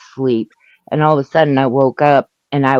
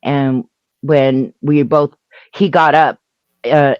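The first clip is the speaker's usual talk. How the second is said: ordinary delivery, nothing marked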